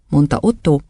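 ordinary delivery

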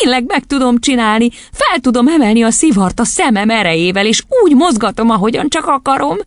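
high-pitched, exultant cheering; jubilant. A narrator's reading. Matilda celebrating moving the cigar with her eyes, jubilant. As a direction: crow with delight, voice staying high. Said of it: "újjongott".

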